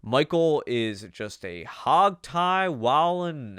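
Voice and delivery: slow, loud Southern country drawl; slow, hearty Southern accent. Shown as a demonstration. Showing off her country bard character's slow, loud Southern accent. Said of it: "southern voice".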